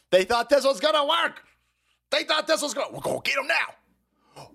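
high mocking gloat; mock gleeful plotter. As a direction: gloat like a cartoon schemer.

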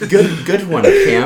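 giggling, rising high on Cam; laughing through it. Real, not acted. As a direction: fight off the laughter while praising. Laughing reply to bad joke.

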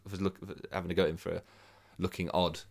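usual speaking voice

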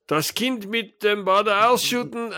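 high, slow, drawn-out German phrase; mock German announcement. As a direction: declaim a silly German exit line. Imagined German exit line, acted.

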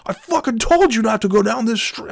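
mock a high, squealing coworker losing it; high whiny meltdown. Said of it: "He's like".

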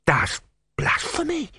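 high, slow, muffled gasp of horror; horrified, hand over mouth. A narrator's reading. Disciple shocked by his god, muffled high horror. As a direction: gasp it slowly through a covering hand. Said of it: "put his hand over his mouth in horror".

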